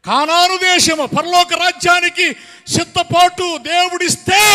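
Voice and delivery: sustained high-energy pulpit exhortation; full-force preaching. Real, not acted. Preacher rebuking neglect of God's gifts, full-force preaching. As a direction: drive home each phrase, stretching the endings.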